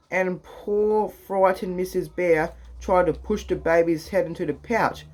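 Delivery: ordinary delivery, nothing marked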